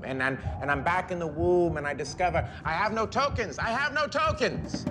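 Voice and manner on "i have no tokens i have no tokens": panicked cry climbing much higher; high-pitched escalating alarm; mounting panic. Acted. Discovers he has no subway tokens.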